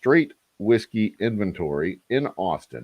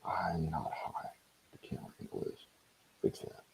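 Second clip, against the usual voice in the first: joke whisper; 16 dB quieter than usual. Pretend. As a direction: whisper it like a secret joke to the camera. Joking aside about being high.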